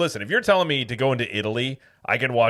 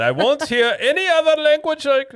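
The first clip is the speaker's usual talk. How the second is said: very high, loud mock-German tirade; hammed-up furious German-style shouting; aggressive German rant; 6 dB louder than usual. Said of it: "this is how you're supposed to say it".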